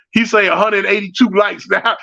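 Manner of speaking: ordinary talk, nothing marked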